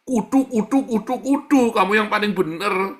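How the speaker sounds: loud, high, stubborn chant of kudu; mocking insistence. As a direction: ham up a know-it-all insisting.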